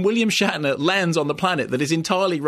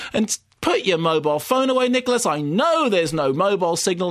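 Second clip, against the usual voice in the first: exasperated groan; 8 semitones higher, slower than usual. Retold or imitated. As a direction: moan in mock despair at the screen. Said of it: "you sit there in the cinema and you go".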